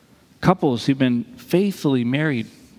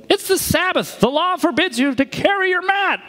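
high, prissy rebuke; a fussy official's high scold; scolding leaders; 13 semitones higher than usual. Acted. Leaders scolding the healed man for carrying his mat, high and fussy.

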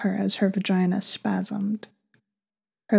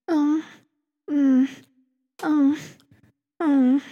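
short high moans; moaning; 7 semitones higher, slower than usual. Acted. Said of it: "She started moaning again in his ear".

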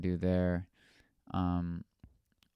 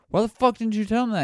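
high-pitched shocked yell; yelling in disbelief; shocked outburst; 12 semitones higher, 5 dB louder than usual. Retold or imitated. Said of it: "And I was like".

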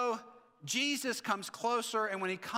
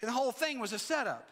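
higher, brisk emphatic declaration; convinced; 5 semitones higher, faster than usual. Shown as a demonstration. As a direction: say it as if you truly believe it. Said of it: "say it like you're convinced".

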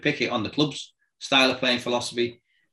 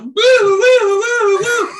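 high loud wailing siren woo; siren impression; 10 dB louder, slower than usual. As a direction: wail it out like a police siren, much higher and louder. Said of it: "and he's doing this".

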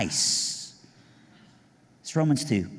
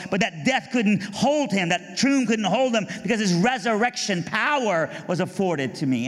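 rising preach; 9 semitones higher than usual. Acted. Preacher building the gospel to a crowd, rising preach. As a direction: preach it with growing force.

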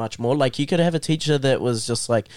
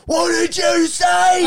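high, drawn-out mock shout; angry teacher; 4 dB louder, slower than usual. Teacher hearing a new kid call him mate, angry. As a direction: re-enact an outraged teacher yelling. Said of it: "he gets so angry".

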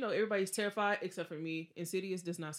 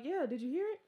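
anxious question; 8 semitones higher than usual. Retold or imitated. Asking neighbours about scream.

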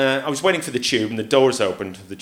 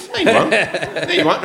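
mock defiant no; 9 semitones higher, 5 dB louder than usual. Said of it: "a really weird response".

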